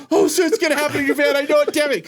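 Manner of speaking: fast, high, panicky cursing; panicked outburst. Retold or imitated. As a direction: spit it out in a retold panic. Panicking about missed turn.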